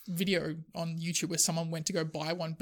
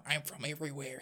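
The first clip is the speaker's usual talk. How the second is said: put-on softer, lower Christopher Lambert everywhere accent; vague everywhere accent; 4 semitones lower, 9 dB quieter than usual. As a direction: murmur it in an accent from nowhere and everywhere. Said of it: "The accents keep changing".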